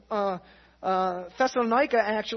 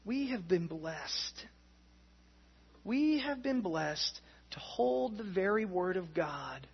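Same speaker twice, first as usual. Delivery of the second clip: slow, much softer closing words; solemn closing; 4 semitones lower, 6 dB quieter, slower than usual. Acted. Pastor closing the sermon to friends, slow and soft. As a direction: bring it down and let it land.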